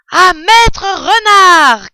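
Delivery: toast hurlé, aigu, syllabes étirées; acclamation criée. A narrator's reading. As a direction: acclame lentement à pleine voix. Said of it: "crièrent-ils en chœur en levant leurs verres". (cri de banquet, très haut et traînant)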